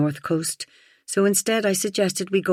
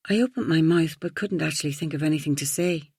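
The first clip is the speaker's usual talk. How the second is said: usual speaking voice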